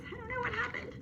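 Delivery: bewildered little dog; confused dog. Retold or imitated. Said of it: "He says".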